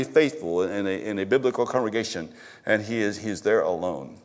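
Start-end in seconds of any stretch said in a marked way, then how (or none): none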